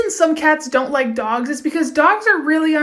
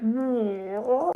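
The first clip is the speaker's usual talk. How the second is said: low, quiet little "no"; deflated prank victim; 3 semitones lower, 9 dB quieter than usual. Acted. Wrong-number caller replying to the prank, low and deflated. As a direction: answer low and small, as the baffled caller.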